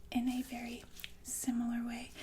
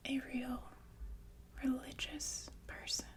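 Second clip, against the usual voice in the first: ordinary delivery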